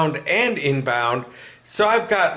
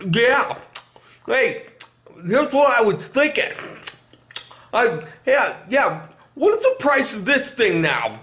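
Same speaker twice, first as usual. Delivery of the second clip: eating while talking; 6 semitones higher than usual. Retold or imitated. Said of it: "don't call in and be like".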